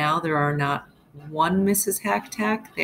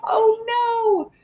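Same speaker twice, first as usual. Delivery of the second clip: much higher hammed-up cry of alarm; mock dismay; 4 dB louder than usual. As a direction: play up the shock for the kids. Dramatic story exclamation.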